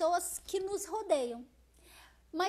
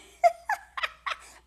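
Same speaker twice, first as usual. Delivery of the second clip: loud, high burst of laughter; hearty laugh; 12 semitones higher, 8 dB louder than usual. Real, not acted. Laughs while thanking a viewer.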